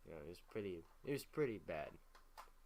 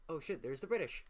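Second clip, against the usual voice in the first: mock alarm; 7 semitones higher than usual. Soldiers spotting the British army, higher mock alarm. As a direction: blurt it like a startled soldier spotting the enemy. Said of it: "And they're like".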